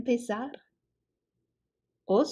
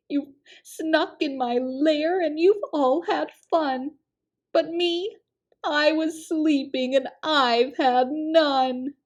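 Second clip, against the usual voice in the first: higher, louder sulky bear complaint; whiny sulking bear; 6 semitones higher, 4 dB louder than usual. A narrator's reading. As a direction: whine like a left-out bear. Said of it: "he whimpers and he moans".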